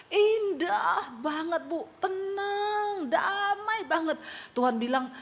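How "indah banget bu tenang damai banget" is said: slow, high, drawn-out awe; hushed rapture, high and slow; dreamy awe. Retold or imitated. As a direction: stretch each word out in wonder at heaven. Quoting man's vision of heaven.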